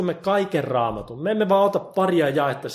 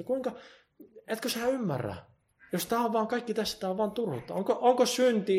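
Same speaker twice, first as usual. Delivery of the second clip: high pleading plea; 7 semitones higher, 4 dB quieter than usual. Acted. Pleading with the unbeliever.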